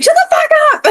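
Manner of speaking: very high-pitched mock snap with a laugh; laughing replay of a snappy retort; retold snap. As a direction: imitate your own snappy outburst, pitch shooting up. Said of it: "I was so snappy".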